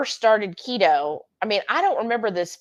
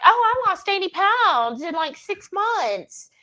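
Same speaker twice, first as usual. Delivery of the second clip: higher, drawn-out boast; imitating dieters who boast of fast results, higher mock smugness; mock bragging; 9 semitones higher, slower than usual. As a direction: mimic the smug weight-loss brag.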